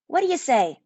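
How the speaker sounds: high, frightened call of his name; terrified girl calling her twin; scared sister. A narrator's reading. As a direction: call out to her brother in fear. Merlinda asks her familiar.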